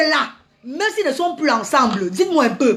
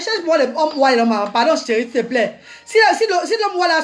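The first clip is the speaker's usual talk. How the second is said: ordinary talk, nothing marked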